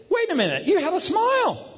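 very high, exclaimed accusation; mock outrage. Dad catching his son smiling, high mock outrage. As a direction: call him out in playful indignation.

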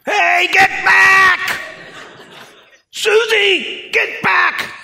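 very high, loud drawn-out shout; yelling at the dog. Retold or imitated. Pastor shouting his runaway collie Susie back, very high and loud. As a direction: holler across the field to call her back.

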